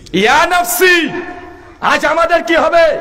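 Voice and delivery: high, ringing wail of dread; acted anguished cry. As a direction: wail it high, as a preacher voicing despair. Acted prophets dreading judgement.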